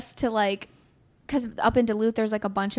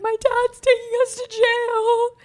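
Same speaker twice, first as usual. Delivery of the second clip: high, loud, drawn-out wail; hammed-up full-on bawling; mock bawling child; 13 semitones higher, 5 dB louder, slower than usual. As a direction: imitate a kid sobbing out bad news. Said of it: "I'm bawling in the backseat".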